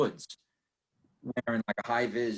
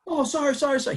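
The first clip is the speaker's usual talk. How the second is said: mock apology; faster than usual. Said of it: "I was like".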